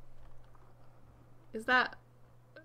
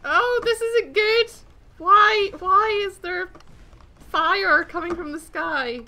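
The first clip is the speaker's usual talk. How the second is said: high, loud panicked wail; alarmed; 8 semitones higher, 10 dB louder than usual. Real, not acted. Gamer under fire from the sky, alarmed wailing. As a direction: wail in dismay, slow at first.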